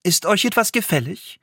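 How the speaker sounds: a hint of a polite vendor; market woman. Hanne offering the old woman her wares, lightly voiced. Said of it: "antwortete die Schustersfrau".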